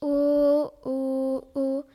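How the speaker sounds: loud, rounded "O, O, O". Acted. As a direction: shout each "O" round and full. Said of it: "comme un petit rond".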